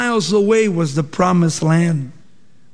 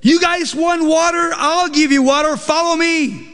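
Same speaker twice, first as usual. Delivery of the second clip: much higher, mock-furious outburst at the crowd; retold angry yelling; 9 semitones higher than usual. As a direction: snap at the people like an exasperated leader. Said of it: "he starts yelling at the people".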